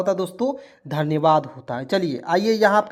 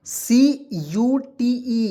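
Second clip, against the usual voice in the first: slow spelling; 5 semitones higher, 4 dB louder, much slower than usual. Shown as a demonstration. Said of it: "teacher spells out CUTE".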